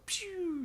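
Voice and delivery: very high imitated whoosh; high "pew" of someone zipping off; zooming-off noise. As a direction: make a cartoon speeding-away sound. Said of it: "in, like, in a blur, the little kid just goes".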